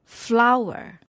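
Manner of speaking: FLOWer with a strong opening; stress on first syllable. Shown as a demonstration. Teacher showing a noun, first syllable stressed in "flower". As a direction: put the weight on FLOW in "flower". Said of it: "the stress is on the first syllable".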